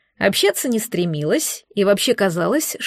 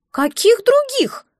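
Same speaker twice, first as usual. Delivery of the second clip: very high, slow, puzzled squeak; sincere bewilderment; 13 semitones higher, slower than usual. A narrator's reading. Egg hears of other eggs, bewildered. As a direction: ask it in baffled creature voice. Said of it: "искренне недоумевало существо".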